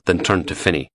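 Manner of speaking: normal talking voice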